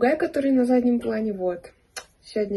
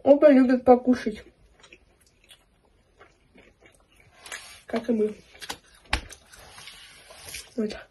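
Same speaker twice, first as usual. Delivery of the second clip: talking while chewing; eating pizza slice; mouth full of pizza. Real, not acted. Seen 2: Holds pizza slice, mouth closed and working, licks fingers between bites.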